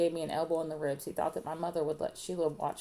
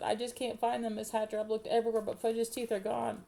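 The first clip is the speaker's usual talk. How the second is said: put-on higher, whiny girl's voice; sniffly; 6 semitones higher than usual. A narrator's reading. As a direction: complain tearfully as a young girl. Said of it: "Sheila sniffled and said".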